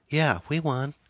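lightly voiced teenage girl, high; teenage daughter. A narrator's reading. Daughter answers mother about the game, high girlish voice. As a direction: pitch it up as an evasive daughter. Daughter fibs after long pause.

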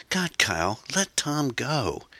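high, drawn-out desperate plea; desperate appeal to a captor; pleading. Acted. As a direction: beg in a high woman's voice. Erin pleads for Tom.

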